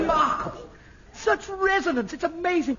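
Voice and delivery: high, loud delighted praise; thrilled admiration. Acted. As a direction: marvel aloud, pitched right up. Director thrilled by the voice.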